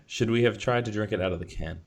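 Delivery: ordinary talk, nothing marked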